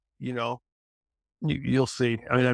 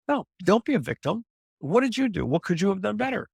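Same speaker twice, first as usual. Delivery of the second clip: much higher-pitched, insistent challenge; challenging self-talk; 8 semitones higher than usual. Acted. Coach challenging someone who blames others for lost clients, insistent. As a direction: push back on the excuses with rising, emphatic questions. Voices tough self-talk against victim thinking.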